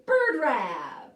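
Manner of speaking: plain reading voice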